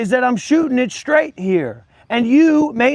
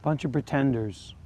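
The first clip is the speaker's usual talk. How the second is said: ordinary delivery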